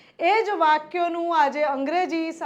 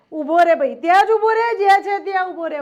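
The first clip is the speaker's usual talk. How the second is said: loud, insistent halt order; commanding; 5 dB louder, faster than usual. Acted. Ordering someone to stand right there, loud and insistent. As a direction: stop them right where they are, loudly.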